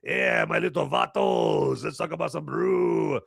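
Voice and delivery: lightly put-on Mexican accent, calling out. Opening the beer review in a hammed-up Mexican accent. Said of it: "talking in a Mexican, uh, accent".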